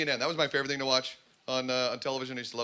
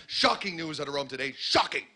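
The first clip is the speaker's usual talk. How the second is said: newsanchor voice. Retold or imitated. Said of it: "Wolf Blitzer breaks into program".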